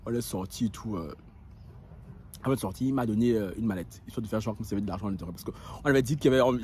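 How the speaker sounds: ordinary delivery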